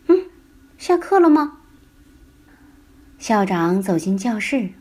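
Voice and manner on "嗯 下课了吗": high, loud startled question; startled waking. Acted. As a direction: jolt awake and ask. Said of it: "她猛地跳了起来，说".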